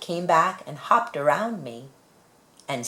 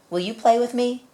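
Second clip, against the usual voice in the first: hopeful asking; 9 semitones higher, 4 dB louder than usual. Acted. Child asks animal to play.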